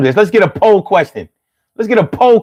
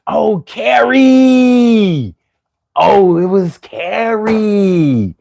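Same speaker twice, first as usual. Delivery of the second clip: Philly holler, vowels dragged out endlessly; drawn-out Philly accent; 3 semitones higher, much slower than usual. Shown as a demonstration. Doing it for all of y'all names, dragged-out Philly accent. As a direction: stretch each name out in exaggerated Philly talk. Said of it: "So I'ma do it for all of y'all names".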